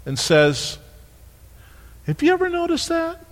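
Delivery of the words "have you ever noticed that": voice gets higher. Shown as a demonstration. Preacher voicing Jesus to the rich young ruler, much higher and softer. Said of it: "Did you hear my voice just get real higher?".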